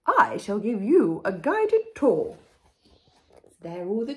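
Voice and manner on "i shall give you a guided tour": slow, loud, posh royal voice; grand queen. A narrator's reading. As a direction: announce it with regal pomp. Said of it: "said the Queen".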